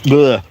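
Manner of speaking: disgusted retching ugh; gagging on a fly. Real, not acted. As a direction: choke out a gag. That was definitely swallowed a fly.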